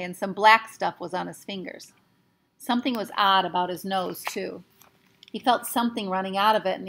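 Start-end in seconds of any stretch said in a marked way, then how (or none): none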